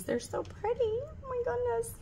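soft, very high-pitched coo; tiny squeaky voice of delight; gushing admiration. Real, not acted. Admiring the pretty keychains from her friend, gushing.

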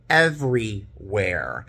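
"everywhere" stretched out slowly; drawn-out emphasis. Real, not acted. Flight attendant recounting the filthy lavatory, slow emphatic "everywhere". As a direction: draw out each part of "everywhere" for emphasis. Repeats the word for disgusted emphasis.

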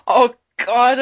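usual speaking voice

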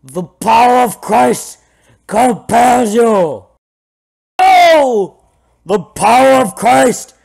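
slow, high, full-force bellow; hammed-up exorcist shout. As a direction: roar it out like a mock exorcism. Acts exorcist during game fight.

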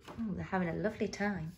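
soft, low, quick comment; hushed aside. A narrator's reading. Reader to children about the happy friends, hushed. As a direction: drop to a soft confiding murmur.

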